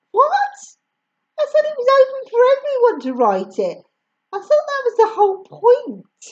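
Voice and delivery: indignant disbelief. A narrator's reading. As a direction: rattle off the protest. Mum indignant.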